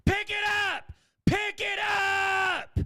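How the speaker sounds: high-pitched, furious mock scream; screamed outburst. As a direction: flip out and scream the order, drawing out the last one. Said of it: "he screams".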